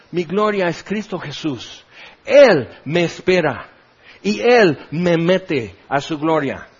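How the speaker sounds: usual speaking voice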